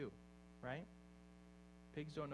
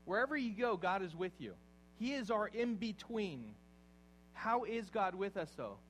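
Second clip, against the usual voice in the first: louder and higher, a triumphant promise; rising proclamation; 4 semitones higher, 6 dB louder than usual. Acted. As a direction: lift the hall with the promise. Builds back to loud preaching.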